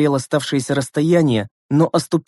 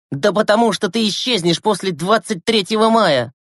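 higher, slightly raised retort; mild acted yell; 7 semitones higher than usual. Gleb yells back at Yura, a mild raised retort. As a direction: bark the answer back, moderately. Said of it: "заорал в ответ Глеб".

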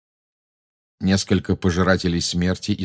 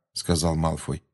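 usual speaking voice

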